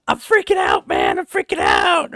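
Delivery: high-pitched panicked shouting, imitated; mock freak-out. Panicking soldier runs up to the patrol, hammed-up frantic shout. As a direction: act out a kid screaming in panic. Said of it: "freaking the hell out".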